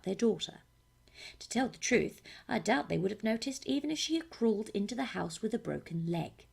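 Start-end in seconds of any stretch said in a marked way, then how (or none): none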